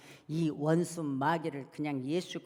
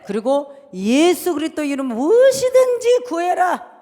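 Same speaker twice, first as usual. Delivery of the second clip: loud, high scriptural proclamation; full-force preaching; 12 semitones higher, 19 dB louder, slower than usual. Real, not acted. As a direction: proclaim the promise in a ringing shout. (힘차게 외치는 말씀 선포)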